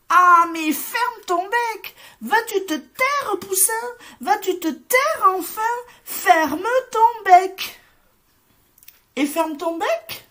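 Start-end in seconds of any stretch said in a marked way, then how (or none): 0.1-7.8 loud scolding shout, pitched high; shouting together. A narrator's reading. Farm animals shouting down the little chick, loud and high. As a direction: yell at the chick to shut up. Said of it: "se mettent à crier".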